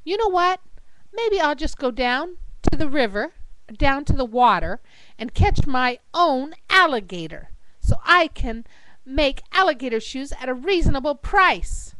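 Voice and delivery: mildly fed-up declaration; frustrated. Acted. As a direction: announce your plan with some exasperation. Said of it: "After becoming frustrated, she said".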